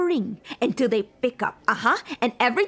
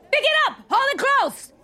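much higher barked orders; angry shout; 12 semitones higher than usual. Acted. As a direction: yell at the terrified guests. Ordering hostages about phones.

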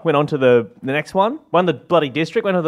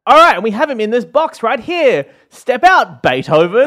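loud announcing; 8 semitones higher, 5 dB louder than usual. Retold or imitated. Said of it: "it was like".

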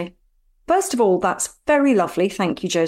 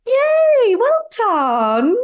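high, loud delighted yay; excited cheer; 12 semitones higher, 3 dB louder, much slower than usual. Real, not acted. Friend cheers co-host's correct quiz answer, excited. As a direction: cheer her right answer.

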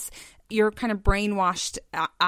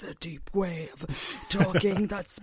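normal talking voice

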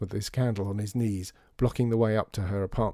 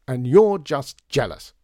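louder, slow, pointed comeback; angry retort; 4 semitones higher, 9 dB louder, slower than usual. A narrator's reading. John answering Veruza's insult, angry retort. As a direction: fling it back with heavy emphasis. Slamming door after a quarrel.

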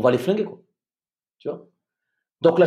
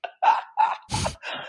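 high laugh; 5 dB quieter than usual. Real, not acted. Laughing at the memory.

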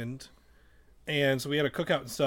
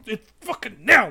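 excited announcement; 7 semitones higher, 9 dB louder than usual. Real, not acted. Dramatic reveal to the listeners.

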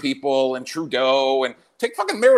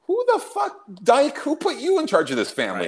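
outraged; 10 semitones higher than usual. Retold or imitated. Outraged at funeral vax check.

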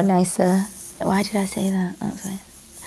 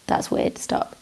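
whispering; 8 dB quieter, slower than usual. Retold or imitated. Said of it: "tell anyone to go".

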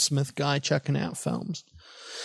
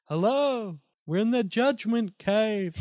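echo voice; 6 semitones higher than usual. Pretend. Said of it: "I'll, I'll add an echo".

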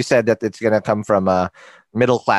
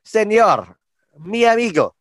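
playful Spanish; 9 semitones higher than usual. Pretend. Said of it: "my Spanish is still on point".

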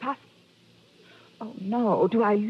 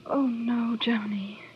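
whispered name, intimate; breathy whisper; slower than usual. Acted. Widow to detective, whispering his name.

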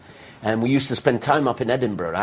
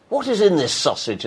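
higher-pitched English diner's query; questioning English visitor; 7 semitones higher than usual. Acted. English visitor asks what's in the sausage, higher-pitched. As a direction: ask it as a puzzled English visitor. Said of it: "I'm from the English side. We go".

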